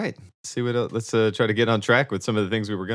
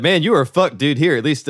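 higher and louder, mock alarmed; cocky voice of a panicking brain; brain talking; 6 semitones higher, 6 dB louder than usual. Brain warns its falling owner, mock alarmed.

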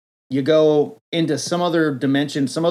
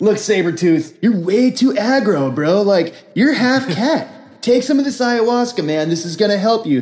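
much higher, louder dopey hippie bro; stoner guru; 6 semitones higher, 4 dB louder than usual. Pretend. Guru greets the heroes, dopey high stoner. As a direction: hippie bro, cheerful then sick. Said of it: "ayahuasca master speaks".

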